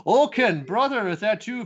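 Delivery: high, loud call to a brother; loud and high, reaching for a lost brother. Acted. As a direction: call to him across the room, hopeful. Brother calling to revived Oaken.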